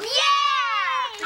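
high excited shout; excited cheer. Real, not acted. Boys cheer sleepover.

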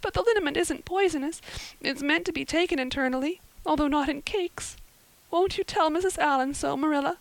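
put-on high, wailing, distraught girl's voice; sobbing girl. A narrator's reading. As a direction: pour out the misery in a high acted wail. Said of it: "sobbed Anne".

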